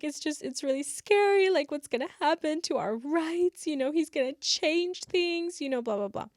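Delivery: lightly voiced, anxious classmate; scared girl. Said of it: "she's like".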